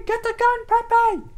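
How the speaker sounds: very high falsetto yell for help; panicked shriek. Pretend. Comic panicked shout.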